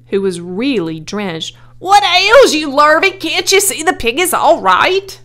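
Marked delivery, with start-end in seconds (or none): 1.8-5.2 high, loud, exasperated scolding; bellowing. A narrator's reading. Drenched owner scolds his helper, high angry bellow. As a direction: bellow the questions at him. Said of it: "bellowed Mister Zuckerman".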